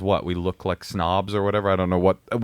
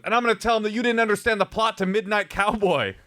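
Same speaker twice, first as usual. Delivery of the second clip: much higher petty-voiced jab; mocking driver; 11 semitones higher than usual. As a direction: mimic the driver's parting shot. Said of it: "he goes".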